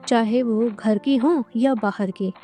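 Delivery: ordinary delivery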